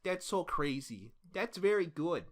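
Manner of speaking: cheery Minnesota golly gee; hammy Minnesota nice voice; Minnesota accent. Pretend. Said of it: "That's a Minnesota accent".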